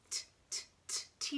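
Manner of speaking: crisp voiceless T ticks; short, separate tongue-tip clicks; ticking watch. Shown as a demonstration. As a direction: tick evenly like a watch. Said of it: "He ticks to tell the time".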